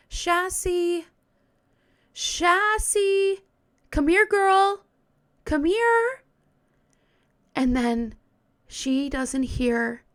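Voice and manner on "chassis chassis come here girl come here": high, louder call out across a yard; calling a dog. Retold or imitated. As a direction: call the dog's name, rising and carrying. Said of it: "she starts calling her dog's name".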